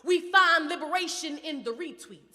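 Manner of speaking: intense, rhythmic poetry performance; spoken word poetry. Acted. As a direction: perform it like a slam poet.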